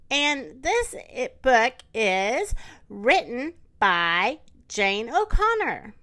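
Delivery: usual speaking voice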